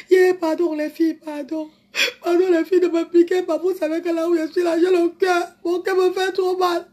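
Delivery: high, hammed-up crying plea; mock tearful pleading. Begging the girls not to sting a fragile heart, mock crying.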